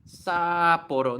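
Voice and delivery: "Sapporo" with a long, loud, open "Saa" and no stop; "Sapporo" slow, first syllable held long; wrong drawn-out pronunciation. Shown as a demonstration. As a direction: stretch the first vowel of "Sapporo". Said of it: "No, you have to cut the sound off".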